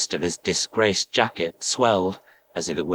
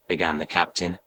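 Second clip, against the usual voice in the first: ordinary delivery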